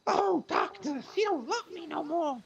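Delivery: very high, slow, mournful moth; sad moth voice. Acted. Moth complaining to the foot doctor, very high and mournful. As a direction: wail like a heartbroken moth. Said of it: "What is that voice?".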